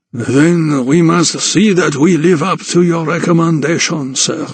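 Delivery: put-on high, accented, courteous tradesman; obliging French barber. A narrator's reading. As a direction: answer a client as a deferential French barber. Barber answers the Count.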